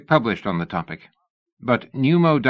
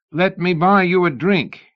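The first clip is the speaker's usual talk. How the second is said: high, loud and slow over the noise; shouting; 10 semitones higher, 5 dB louder than usual. A narrator's reading. Mallory shouting an invitation over the engine din. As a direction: bellow it slowly so he hears you. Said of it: "he shouted".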